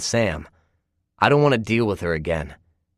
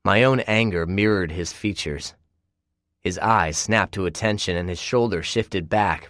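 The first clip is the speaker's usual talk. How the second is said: ordinary delivery, nothing marked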